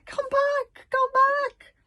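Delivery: very high, loud panicked shout. Acted. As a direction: scream it after them.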